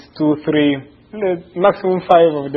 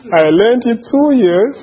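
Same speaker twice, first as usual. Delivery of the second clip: giddy laughing joy; 9 semitones higher, 4 dB louder than usual. Real, not acted. Joyful memory of baptism party.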